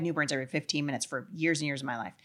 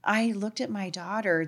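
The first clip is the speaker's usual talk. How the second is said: ordinary talk, nothing marked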